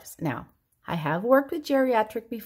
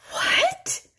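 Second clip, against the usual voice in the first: put-on much higher, whispered confused old man; dad whispering "What?". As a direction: squeak out a baffled "what?" like dad. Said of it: "This is my dad".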